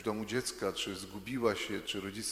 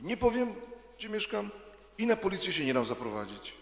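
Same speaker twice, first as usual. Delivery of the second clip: put-on high, stubborn child's voice; little Ania refusing, much higher and defiant; stubborn little girl; 10 semitones higher, 3 dB louder than usual. A narrator's reading. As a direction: dig in your heels like a small girl.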